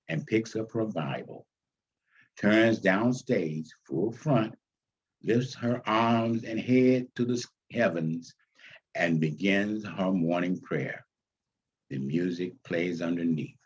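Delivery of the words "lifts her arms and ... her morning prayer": normal talking voice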